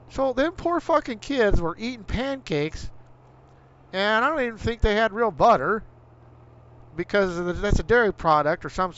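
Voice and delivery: worked-up, indignant rant; angry rant. Real, not acted. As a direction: vent hard about the pancakes. Ranting about bland school food.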